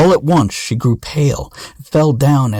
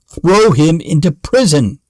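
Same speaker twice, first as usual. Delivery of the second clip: a raised, angry royal command; king snapping out a sharp order, higher; angry cry; 5 semitones higher, 3 dB louder than usual. A narrator's reading. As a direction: order him to prison in a mild fury. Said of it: "grew very angry and cried out".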